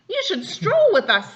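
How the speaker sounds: high, loud peacock voice; a proud peacock calling out high. A narrator's reading. Father reading to child, high loud peacock voice. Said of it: "said the peacocks".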